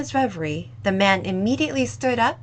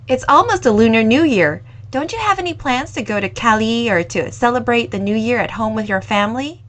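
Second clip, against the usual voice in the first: put-on louder, friendly young woman voice; a lively young cafe owner, voiced louder; 9 dB louder, faster than usual. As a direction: owner kindly offering the lingering customer more time, louder.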